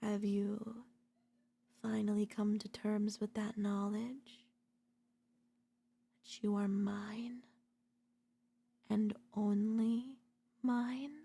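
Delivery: slow possessive whisper in the ear. Acted. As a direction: breathe it close and slow, claiming them. Lover murmuring possessively close in.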